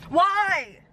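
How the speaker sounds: high, drawn-out wail of why; anguished protest. Real, not acted. Vlogger asking why the couple couldn't end together, anguished protest. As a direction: cry it out in exasperation.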